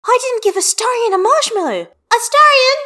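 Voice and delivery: put-on high, loud little girl's shout; a kid calling her friend back in a rush; child calling out. A narrator's reading. Child figure Hestia.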